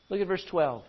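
ordinary talk

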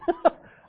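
a loud, high burst of laughter; a real laugh after the punchline; hearty laugh. Real, not acted. Laughing at his own story.

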